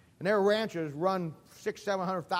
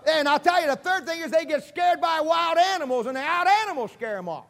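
put-on old shepherd voice; 9 semitones higher, 7 dB louder than usual. Preacher voicing old shepherd.

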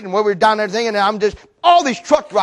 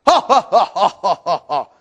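hammed-up scoffer's laugh, falling in pitch; forced mock ha-ha-ha; 2 semitones lower than usual. Scoffers laughing at the wall, fake mocking laugh. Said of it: "they're laughing".

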